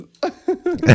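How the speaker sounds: loud squealing laugh; high burst of laughter. Real, not acted. Big laugh.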